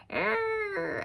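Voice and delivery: put-on high, hunched old woman's croak. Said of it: "who talks like this".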